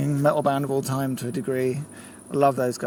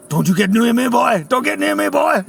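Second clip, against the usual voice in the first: loud, higher mock threat; angry warning; 6 semitones higher, 4 dB louder than usual. Singer warning off a fan, loud mock threat. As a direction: bark it at a pestering fan. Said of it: "And he was like".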